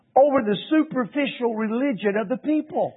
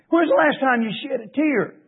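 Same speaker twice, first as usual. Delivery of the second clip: ordinary talk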